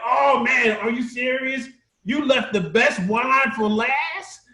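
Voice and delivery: high, amazed exclamation from the wedding crowd. Retold or imitated. Preacher voicing a stunned wedding crowd, high amazed disbelief. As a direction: gasp it out like a stunned partygoer.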